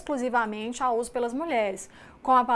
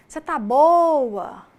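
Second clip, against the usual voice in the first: "Você tá boa?" slow, drawn-out, louder and sung; sing-song mineiro; 4 semitones higher, 9 dB louder, much slower than usual. Shown as a demonstration. Teacher demonstrating sung mineiro intonation for the class. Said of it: "um exemplo simples é falar assim".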